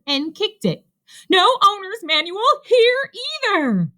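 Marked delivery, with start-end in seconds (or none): 1.3-3.9 high, loud, frantic shout. A narrator's reading. Rabbit searching the coat rack, shouting. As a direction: shout it in frustration. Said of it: "he shouted".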